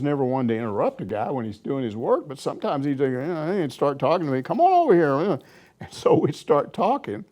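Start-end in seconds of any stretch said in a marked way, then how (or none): 4.4-5.1 raised voice, higher, calling someone over. Retold or imitated. Coach calling reporter over, raised voice. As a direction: call him over from third base.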